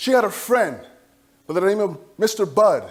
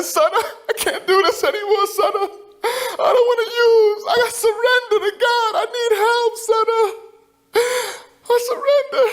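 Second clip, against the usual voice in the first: much higher, slow tearful pleading; crying; 13 semitones higher, slower than usual. Retold or imitated. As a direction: voice breaking with sobs. Mother breaks down at Thanksgiving.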